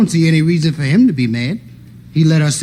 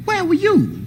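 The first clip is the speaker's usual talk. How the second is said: high, sharp suspicious question; high suspicious demand; 13 semitones higher, slower than usual. Retold or imitated. Uncle demands at door.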